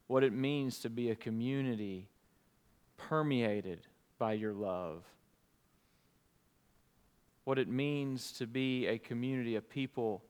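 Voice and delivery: hushed prayer voice. Acted. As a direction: pray quietly and earnestly. Pastor leads congregation in prayer.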